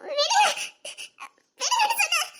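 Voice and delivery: high-pitched insistent cry; very high, urgent plea; distressed plea. Acted. Insisting to be let go.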